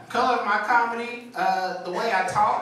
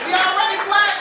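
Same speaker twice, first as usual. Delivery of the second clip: punchline yell; 12 semitones higher, 8 dB louder than usual. Acted. Punchline shouted.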